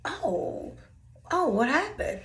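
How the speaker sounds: mock innocent. Playing innocent again.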